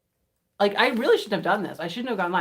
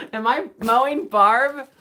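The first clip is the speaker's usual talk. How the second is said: loud, high, slow mock horror; mock shock; 7 semitones higher, 8 dB louder than usual. Real, not acted. Realizing the poem's double meaning, in loud high mock shock. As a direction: gasp it out scandalized.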